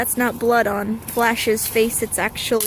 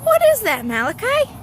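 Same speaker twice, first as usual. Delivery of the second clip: much higher and louder, amazed; excited; 11 semitones higher, 5 dB louder than usual. Real, not acted. Owner asks her dog what he's doing, high and loud. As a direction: shout up at the dog in disbelief.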